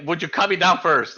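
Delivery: begging to be cut down; pleading dwarf. Acted. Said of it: "before he's like".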